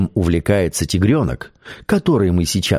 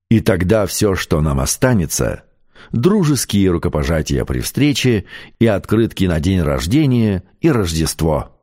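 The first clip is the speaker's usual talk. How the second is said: plain reading voice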